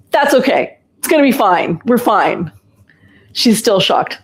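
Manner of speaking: usual speaking voice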